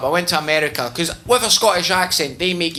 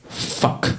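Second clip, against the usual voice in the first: whispered fuck; 4 dB quieter than usual. Acted. Brain panicking.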